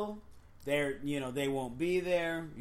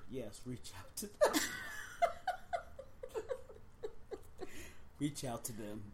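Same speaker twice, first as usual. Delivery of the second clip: stifled giggles; 5 semitones lower, 10 dB quieter than usual. Real, not acted. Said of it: "She's serious".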